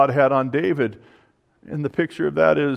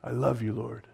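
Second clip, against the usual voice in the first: "I love you, Lord" much softer, fading almost to nothing on "Lord"; near-whisper repeat; 11 dB quieter than usual. Retold or imitated. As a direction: breathe "I love you, Lord" as a hushed prayer. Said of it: "and powerful".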